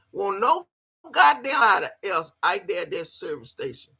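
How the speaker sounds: usual speaking voice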